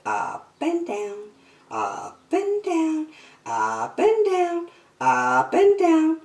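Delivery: seesaw the pitch like a jiggled line; singsong up and down. A narrator's reading. Narrator acts jiggling line.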